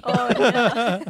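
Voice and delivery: laughing through the words; stifled giggles. Real, not acted. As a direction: giggle while saying it. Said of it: "I need to stop laughing".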